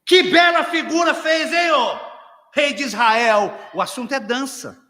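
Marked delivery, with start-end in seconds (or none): 0.0-3.5 shouted sarcastic jab at the king; mocking wife. Mical mocks David dancing. (esposa zombando, gritando)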